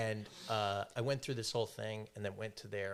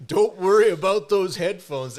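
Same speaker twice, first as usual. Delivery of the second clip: higher, much louder, laughing; hammed-up laughing lie; 8 semitones higher, 15 dB louder than usual. Lying to the manager, high, loud and laughing. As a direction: ham up the fake excuse. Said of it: "I would have said that".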